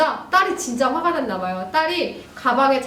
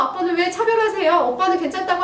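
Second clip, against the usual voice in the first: higher, sulky protest; angry daughter; 7 semitones higher, 3 dB louder than usual. Acted. Daughter protests dad's unfair treatment, mildly angry. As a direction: complain like an upset teen. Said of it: "딸도 이제 화가 난 마음에".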